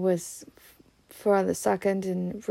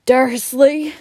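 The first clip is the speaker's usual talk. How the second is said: loud, higher-pitched angry yell; 6 semitones higher, 11 dB louder than usual. A narrator's reading. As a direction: bellow the name in rage. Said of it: "he yellowed".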